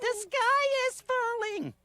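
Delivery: ordinary delivery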